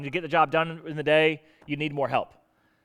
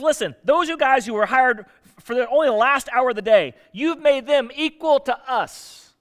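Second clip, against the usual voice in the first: higher, louder griping worker, slowing at the end; grumbling complaint; 5 semitones higher, 4 dB louder than usual. Acted. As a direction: gripe at the boss like you've been cheated. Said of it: "they began to grumble against the landowner".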